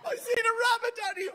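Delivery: very high, whiny mock child voice; frightened little boy. Son to Daddy about the rabbit, high scared voice. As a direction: pitch it right up like a scared kid.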